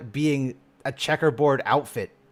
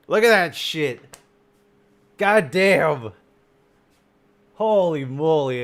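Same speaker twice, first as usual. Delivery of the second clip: louder, slower and higher, in real awe; awed exclamation; 4 semitones higher, 5 dB louder, slower than usual. Real, not acted. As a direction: gush over the artwork. Reacting to redesign art.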